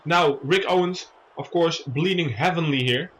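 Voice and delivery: normal talking voice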